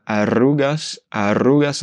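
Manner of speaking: "arrugas" with a strong trilled rr; rolled r. Shown as a demonstration. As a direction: roll the rr in "arrugas" hard. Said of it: "c'est un R double".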